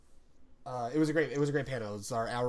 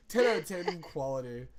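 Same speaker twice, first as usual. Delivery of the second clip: usual speaking voice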